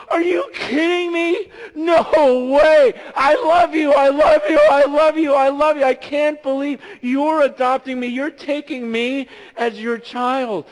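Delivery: high loud joyful shouting; imitating the daughter's shouts of love; ecstatic April. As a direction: cry out in overjoyed disbelief. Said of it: "be like April this morning going".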